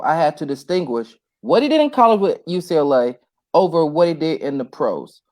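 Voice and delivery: usual speaking voice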